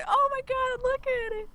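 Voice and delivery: high squealing oh my God over a pet; excited squeal. Retold or imitated. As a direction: gush high and giddy over a cute dog. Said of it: "hear you going".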